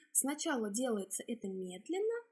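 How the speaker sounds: normal talking voice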